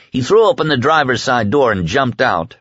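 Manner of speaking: plain reading voice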